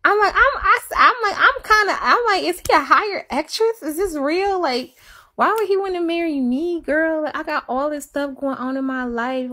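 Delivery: high-pitched, stammering disbelief; incredulous venting. Retold or imitated. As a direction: pitch it high, tumbling over false starts. Retells disbelief to her friend.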